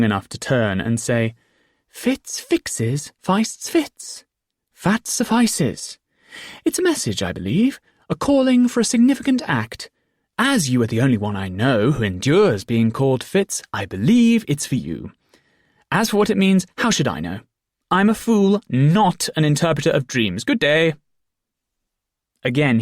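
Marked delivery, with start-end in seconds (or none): 1.9-6.0 high, lilting, slowing on fat suffices; fool's rhyme. A narrator's reading. The fool turning to repeat the message, lilting high. 6.6-20.9 high, arch and dismissive; high fool's voice. A narrator's reading. The fool dismissing the boy's questions, arch and high. Fool explaining his message.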